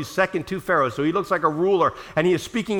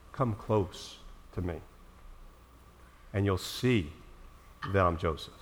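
soft invitation; 10 semitones lower, 10 dB quieter than usual. Acted. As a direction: draw them in gently. Joseph softly invites brothers.

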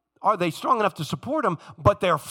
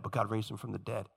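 quieter echo, trailing off on "dead"; fading repeat; 11 semitones lower, 13 dB quieter than usual. Shown as a demonstration. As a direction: say it again almost to yourself. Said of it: "this theme constantly".

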